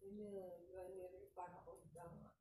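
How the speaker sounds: quiet. Real, not acted. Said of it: "Inom pa more".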